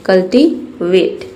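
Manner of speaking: कल्टीवेट said very slowly, syllable by syllable; slow model word. Shown as a demonstration. Teacher models कल्टीवेट slowly for her students. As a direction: draw out कल्टीवेट for the class to copy. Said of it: "कल्टीवेट means होता है उगाना".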